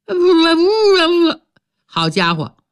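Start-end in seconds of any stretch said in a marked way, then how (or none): 0.1-1.4 慢慢地边哭边说不; 哭着拼命摇头; sobbing denial. Acted. 六指哭着否认. (六指带着哭腔连声说不)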